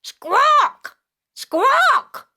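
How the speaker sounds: high squawking parrot call. Shown as a demonstration. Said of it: "A parrot goes like this".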